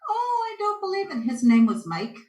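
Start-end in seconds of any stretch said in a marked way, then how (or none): 0.1-1.3 high astonished exclamation; amazed bus driver. Retold or imitated. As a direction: gasp it out in disbelief. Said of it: "he's like".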